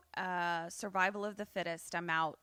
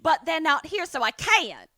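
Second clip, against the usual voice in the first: mock Cockney-Southern accent; 6 semitones higher, 9 dB louder than usual. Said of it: "your Cockney goes into Southern".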